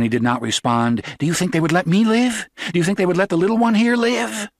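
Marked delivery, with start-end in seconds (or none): 1.2-4.5 high, urgent goading plea, fast at the end; a mother goading her husband to flee. A narrator's reading. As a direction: push him, voice climbing higher. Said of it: "Her goading".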